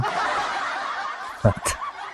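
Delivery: quiet airy chuckle; soft breathy laugh. Real, not acted. Vlogger laughing at a wrong sound effect, soft chuckle. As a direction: laugh under the breath at a mistake. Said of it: "Mali yung sound effect".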